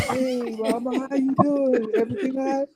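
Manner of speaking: sweet voice. Retold or imitated. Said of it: "L got in this little sweet voice".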